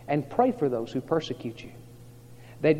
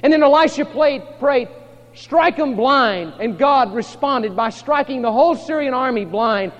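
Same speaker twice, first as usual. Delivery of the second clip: loud, high-pitched sermon storytelling; full-force preaching; 10 semitones higher, 11 dB louder than usual. Acted. Preacher telling of the blinded army, full force.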